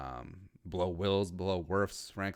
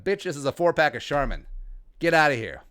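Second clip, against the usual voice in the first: mock defiant retort; 5 semitones higher, 5 dB louder than usual. Snapping back at judging shoppers.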